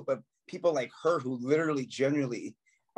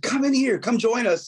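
calling out; 9 semitones higher, 5 dB louder than usual. Retold or imitated. Inviting fan in through glass.

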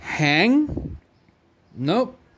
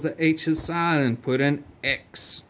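usual speaking voice

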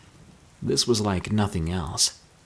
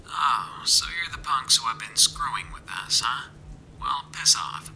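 higher, muffled, irritated radio voice; radio reply; 4 semitones higher, 4 dB quieter than usual. A narrator's reading. Stranger tells off Mika over radio, annoyed. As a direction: sneer back through a faint radio. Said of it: "the radio replied".

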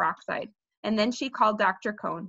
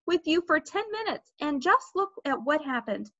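higher, mildly angry scolding; raised voice; 7 semitones higher than usual. A narrator's reading. Mother blames Peter for Fudge's fall, raising her voice. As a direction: scold him, slowing on the last sentence. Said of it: "My mother raised her voice".

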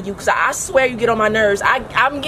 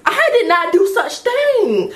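high, slow, laughing protest; outraged betrayal, pitched way up; shocked squeal; 9 semitones higher than usual. Acted. Caught, betrayed by sister.

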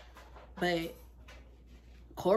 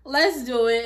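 "Let's do it" punched out louder, pumped; rally yourself up, louder this time; louder hype; 3 semitones higher, 7 dB louder than usual. Shown as a demonstration. Hyping herself up for the new job, louder.

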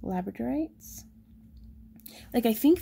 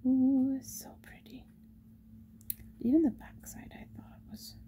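breathy admiring whisper; 6 semitones higher than usual. Real, not acted. Shop owner whispering over a pretty stone, hushed. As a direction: hush over a lovely stone.

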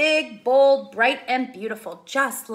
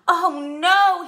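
dismayed; 7 semitones higher, 8 dB louder than usual. Acted. Dismay at rain.

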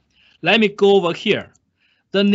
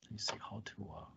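whispered aside; 7 semitones lower, 26 dB quieter, much faster than usual. Real, not acted. Muttering to himself at computer.

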